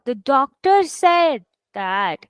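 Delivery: slow, with "doctor" and "said" both stressed; deliberate weight on "doctor" and on "said". Shown as a demonstration. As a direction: stretch out "doctor" and "said" equally. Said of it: "Here you are stressing on both".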